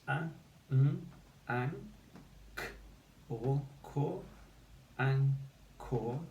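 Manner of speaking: anchor built up sound by sound. Shown as a demonstration. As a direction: split anchor into an and kor, full or vowel. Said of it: "two syllables".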